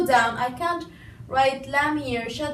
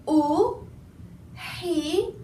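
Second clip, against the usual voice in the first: ordinary talk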